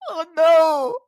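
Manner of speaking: hysterical laughter. Real, not acted. Streamer losing it at the video for chat, hysterical laughter. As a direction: laugh so hard it turns to tears. Watching the walking video live.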